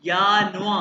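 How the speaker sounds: यानुआ with open vowel ending; pronunciation model. Shown as a demonstration. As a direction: model German यानुआ with a y and no final r. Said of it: "ये pronounce करना है".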